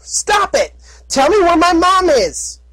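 high, pleading filly shout; young filly voice. Acted. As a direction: shout like a frantic little filly. Filly character voice.

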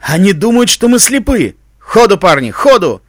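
loud, irritated commander's shout; curse, then drive the men onward; angry cursing. A narrator's reading. Ranger curses the third fake trap, angry shout. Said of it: "егерь грязно выругался".

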